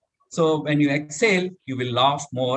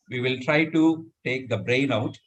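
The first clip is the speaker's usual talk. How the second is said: normal talking voice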